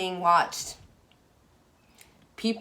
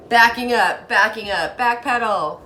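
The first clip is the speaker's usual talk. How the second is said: loud mock backpedal; 4 semitones higher, 9 dB louder than usual. Acting out backing away.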